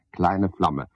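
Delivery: ordinary delivery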